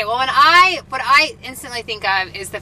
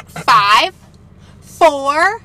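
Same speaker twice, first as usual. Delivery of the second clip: loud countdown; 4 semitones higher, 8 dB louder than usual. Retold or imitated. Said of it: "I'll be like".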